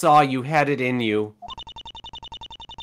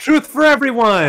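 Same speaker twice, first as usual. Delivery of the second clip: mock grand proclamation; 11 semitones higher, 6 dB louder than usual. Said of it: "as Vex Volper would say".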